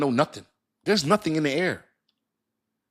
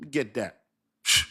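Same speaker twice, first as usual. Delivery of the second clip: normal talking voice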